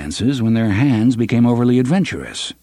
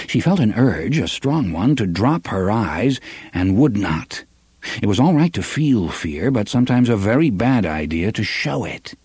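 ordinary delivery

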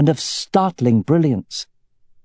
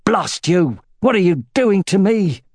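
put-on panicked old man, voice shooting up high; alarmed cry; 8 semitones higher than usual. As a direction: cry out, frightened and furious. Sloane cries out at the spell.

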